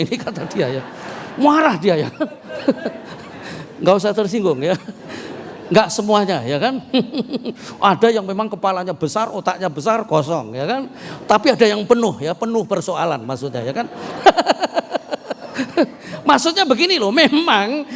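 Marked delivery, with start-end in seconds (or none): 1.4-7.9 chuckling through the words; laughing. Real, not acted. Preacher laughing at his joke about women's brains. 14.0-16.2 laugh. Real, not acted. Laughs at own joke.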